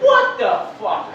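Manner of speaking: very high, slow incredulous cry; baffled outburst. Retold or imitated. Newcomer in Los Angeles reacting to weird things, incredulous. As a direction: stretch it out in disbelief.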